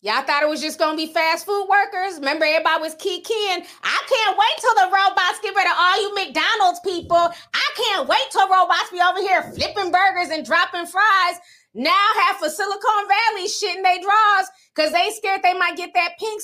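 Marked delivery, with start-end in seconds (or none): 3.8-11.4 a touch of smug gloating, lightly higher; mock the people cheering, raised voice; mocking quote. Mocking people cheering job losses.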